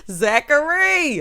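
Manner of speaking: drawn-out, high, loud call of the name; Zachary! stretched long and loud. Real, not acted. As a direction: call Zachary out slowly like a scolding aunt. Calling out the character.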